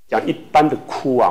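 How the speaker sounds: ordinary talk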